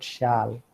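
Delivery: "shall" with an open ah vowel; mispronounced shall. Shown as a demonstration. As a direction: say "shall" wrongly as shahl. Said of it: "but it is not".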